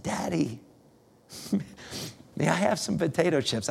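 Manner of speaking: softly imitated tearful child; almost in tears. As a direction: act out a small, hushed plea to Daddy. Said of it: "He's almost in tears".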